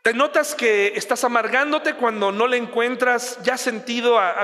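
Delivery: ordinary talk, nothing marked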